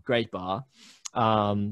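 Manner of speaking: ordinary talk, nothing marked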